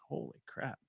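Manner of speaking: soft breathy whisper of disbelief; hushed reaction to a crazy story; whispered awe. Retold or imitated. Quoted reaction to a wild story.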